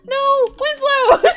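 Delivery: drawn-out high, loud wail; dismayed shout. Real, not acted. Player losing the worm Winslow, dismayed shout. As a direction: cry out in playful despair.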